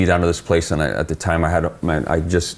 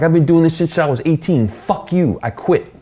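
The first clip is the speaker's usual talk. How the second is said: shouting back; 11 semitones higher than usual. Retold or imitated. Quitting on the boss, retold furious yell. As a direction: throw it back at him and quit.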